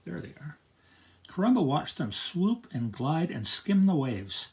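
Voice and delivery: plain reading voice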